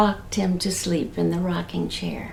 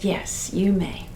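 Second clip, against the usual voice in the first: soft whisper; 2 semitones lower, 6 dB quieter, slower than usual. Acted. Mother grants permission softly.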